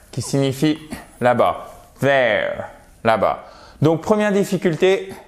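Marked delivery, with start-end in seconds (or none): 2.0-2.6 THERE with a voiced TH, tongue between the teeth; th anglais correct. Shown as a demonstration. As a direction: say THERE with a buzzing English TH. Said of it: "un son vraiment puissant". (« there » avec le th anglais, langue entre les dents)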